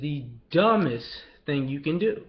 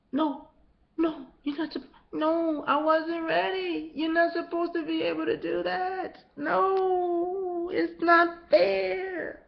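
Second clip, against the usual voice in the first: much higher, crying complaint; mock whining; much slower than usual. Mocked victims complaining it's not fair, high and crying. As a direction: whimper like a sore loser.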